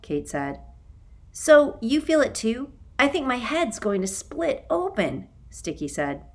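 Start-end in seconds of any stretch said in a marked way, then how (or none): 1.3-5.3 character voice. A narrator's reading. Boy with a splitting headache.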